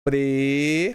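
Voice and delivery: "Pre-" held long as a lone syllable. Shown as a demonstration. Teacher starts splitting the word for pupils, syllable by syllable. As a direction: stretch out "Pre-" and stop. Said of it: "Como é que se separa prejuízo?".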